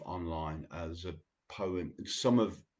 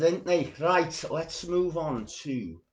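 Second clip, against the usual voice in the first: put-on cod Scouse accent; 11 semitones higher, 10 dB louder than usual. Host moving on to the next poem, in a mock Scouse accent. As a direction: slip into a half-joking Liverpool Scouse lilt. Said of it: "going into a pathetically cod Scouse accent".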